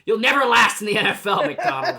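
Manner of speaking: loud, high pretend scolding; hammed-up shout through laughter; mock coach yelling. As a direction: bawl out a player as a joke coach. Playing coach scolding upset player.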